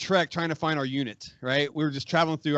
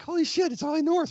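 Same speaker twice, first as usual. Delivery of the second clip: high-pitched retold amazement; reenacted awe, voice leaping up; starstruck; 11 semitones higher than usual. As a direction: gush like a fan spotting a hero. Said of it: "I was starstruck".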